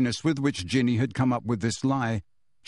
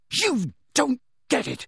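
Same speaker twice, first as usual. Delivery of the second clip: put-on raised, exasperated teenage protest; frustrated shout; 11 semitones higher than usual. A narrator's reading. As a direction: shout it in frustration, each word stretched. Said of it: "Harry shouted at her".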